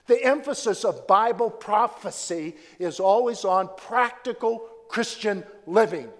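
ordinary delivery

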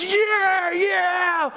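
hammed-up fan whoop; mock sports fan cheer. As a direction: cheer on the team from the couch. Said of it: "people with sporting events".

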